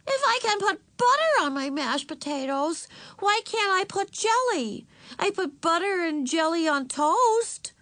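put-on very high, protesting child voice; arguing little girl. A narrator's reading. Little sister arguing with her parents, protesting. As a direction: argue it high like an indignant child.

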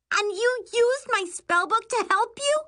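very high, shrieked disbelief; outraged accusation. A narrator's reading. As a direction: accuse your sister, appalled. Sister outraged at confession.

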